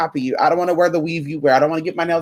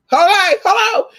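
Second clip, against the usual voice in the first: high chirpy hello, much higher and louder; mock friend greeting; 14 semitones higher, 6 dB louder than usual. Acting out friends asking.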